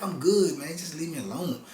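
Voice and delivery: mock irritated "leave me alone"; curt brush-off. His curt reply to officer.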